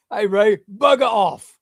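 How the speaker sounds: loud, high, drawn-out mock Cockney; slow, cheeky put-on British. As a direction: tell him off in a hammed-up English accent. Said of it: "maybe he needs to be less classy".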